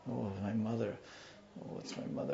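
hushed near-whisper, acted; hidden boy. Boy under the bed hearing his mother, hushed. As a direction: drop to a very soft murmur, as if hiding under a bed. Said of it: "the boy under the bed, he's like".

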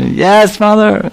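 high-pitched answering call; piercing reply across the village; yelling back. Acted. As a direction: imitate a little girl calling back to mother. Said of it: "they're yelling back and forth".

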